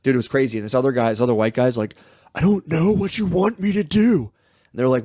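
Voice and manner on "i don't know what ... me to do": exaggerated helpless pleading. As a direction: imitate a man at his wits' end.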